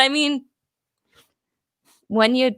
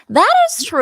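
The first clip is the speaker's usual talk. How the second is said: high playful; 13 semitones higher, 4 dB louder than usual. Real, not acted. High repeat.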